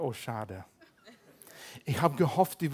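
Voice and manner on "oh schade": soft, deflated little "Oh, schade"; mock disappointed sigh. Pastor answering old acquaintances, quietly and mockingly disappointed. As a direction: let it sink, a quiet mock letdown. Old friends call him same.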